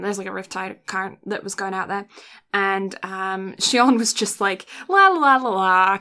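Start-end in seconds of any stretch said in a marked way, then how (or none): 4.9-6.0 carefree mock sing-song; oblivious la-la-la. Host imitating the girl drifting off on her raft, oblivious sing-song.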